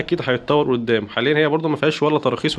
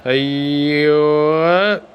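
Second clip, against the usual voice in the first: أيوة drawn out slowly, louder; long drawn-out yes; 4 dB louder, much slower than usual. Real, not acted. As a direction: stretch the word out slowly and a bit louder. Streamer reacting to replay.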